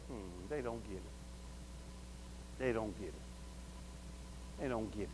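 very low, barely audible murmur; muttering to oneself. Acted. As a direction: mutter it slowly under your breath. Muttering to oneself about people.

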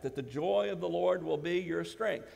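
usual speaking voice

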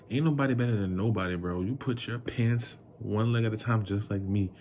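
usual speaking voice

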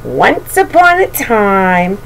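usual speaking voice

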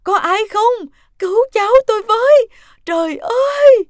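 very high, very loud cries for help; terrified shouting at the top of the voice; panicked screaming. Acted. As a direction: scream desperately for someone to come. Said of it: "Bà hốt hoảng hét lớn".

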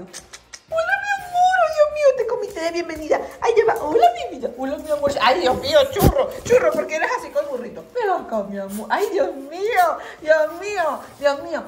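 very high, loud pet voice. Real, not acted. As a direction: coo in a sky-high voice at the dogs. Greeting dogs on return. (voz aguda de cariño para sus perros)

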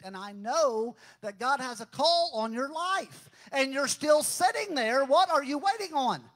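loud, high, impassioned sermon climax; fiery preacher at the peak of the sermon; full-force preaching. Real, not acted. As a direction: shout the call at the congregation.

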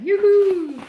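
high, loud sing-song call; sing song yoo hoo. Real, not acted. Calling out on getting in.

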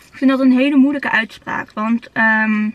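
talking while chewing behind hand; muffled through fingers; hand over mouth. Real, not acted. Seen 4: Eats a bite at 2.2, then covers mouth while chewing and talking.

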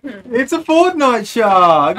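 drawn-out, high, loud announcement; slow, big mock hype reveal; hammed-up excitement. Gift giver hypes a silly gift.

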